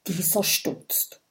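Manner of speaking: usual speaking voice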